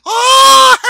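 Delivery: high shrieking witch cry into laughter. Acted. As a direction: let out a loud witch shriek. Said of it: "That sounded like a witch, too".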